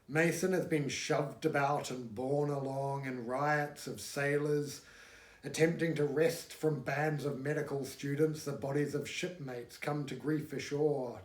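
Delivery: ordinary delivery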